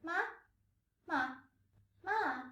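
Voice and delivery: "ma" sliding off the high note, up or down; wavering first tone. Shown as a demonstration. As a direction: botch a high-level "ma" by bending the pitch. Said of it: "unconsciously raise or lower the tone".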